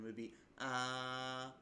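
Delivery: ah held level and slow, Mandarin first tone; ah in a flat Mandarin first tone. Shown as a demonstration. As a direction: sing ah on one steady pitch. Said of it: "either in a flat tone".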